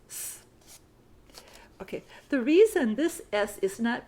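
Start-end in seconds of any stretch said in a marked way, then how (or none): 0.1-0.4 "s" as a soft voiceless hiss; just hiss a quiet "s"; s sound. Shown as a demonstration. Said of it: "The S here is pronounced s".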